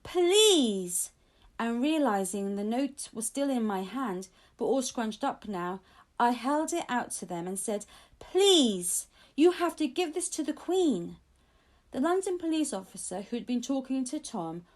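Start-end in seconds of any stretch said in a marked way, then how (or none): 0.0-1.1 loud, high plea; a child's loud pleading cry; cried out. A narrator's reading. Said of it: "I sat up and cried out". 8.2-11.2 pleading. A narrator's reading. Child pleads with guards.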